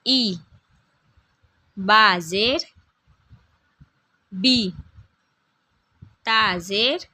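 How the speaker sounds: slow, drawn-out chanted letter drill; teacher's call-and-repeat reading of Arabic letters; Qaida drill recitation. Shown as a demonstration. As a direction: recite each syllable slowly for pupils to repeat.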